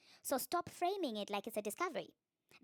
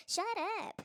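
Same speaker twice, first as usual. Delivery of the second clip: high, drawn-out dismissive retort; exaggerated high-pitched put-down; put-on character voice; 9 semitones higher than usual. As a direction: snap out of patience in a pitched-up character voice. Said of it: "I don't say "shut up" like that".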